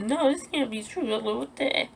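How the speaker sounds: high, soft tearful disbelief; retold crying. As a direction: re-enact crying in a small high voice. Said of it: "no, I was crying".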